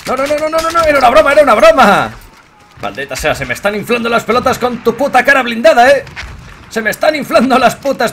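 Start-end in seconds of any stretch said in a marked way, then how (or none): 0.1-2.1 high, loud panicked protest; panicked yelling. Real, not acted. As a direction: shout it out in panic. Panicked denial in game.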